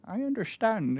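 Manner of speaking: the frazzled butler, hair on end, stammers; flustered old butler. Acted. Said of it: "and he goes".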